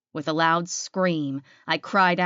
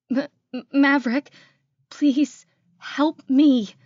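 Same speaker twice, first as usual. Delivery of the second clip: high, stammering, frightened cry for help; stammer, then plead slowly and loudly; trembling plea; 9 semitones higher, 7 dB louder than usual. Acted. Frightened woman begging Maverick for help on the phone, trembling. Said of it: "I said with my trembling voice".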